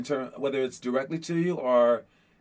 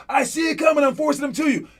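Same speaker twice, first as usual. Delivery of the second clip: high, loud court shout; teammate calling back; 9 semitones higher, 3 dB louder than usual. Retold or imitated. Teammate shouting back during defense. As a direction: answer your teammate over the noise. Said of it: "he looks back at you and goes".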